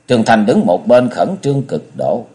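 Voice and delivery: ordinary delivery, nothing marked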